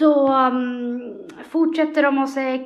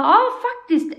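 eager yes; 10 semitones higher, 5 dB louder than usual. Retold or imitated. Friend's eager reply, retold.

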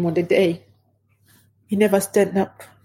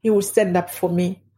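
usual speaking voice

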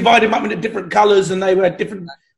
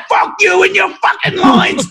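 high, loud, slow furious bellow; mock angry yell; 11 semitones higher, 6 dB louder than usual. Director yelling at the actor over his lines, mock furious shout. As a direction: spit each word out like a fed-up director.

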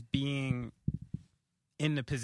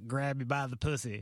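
lightly voiced Donald Trump; a light hint of Trump's voice; Donald Trump impression. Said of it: "my Donald Trump impression".

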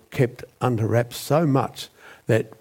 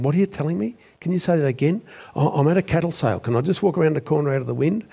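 flustered shock; 7 semitones higher, faster than usual. Retold or imitated. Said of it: "he stuttered".

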